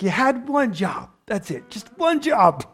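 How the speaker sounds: a hammed-up disappointed God; God voiced as a fed-up parent; God exasperated. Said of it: "That's what God's probably thinking".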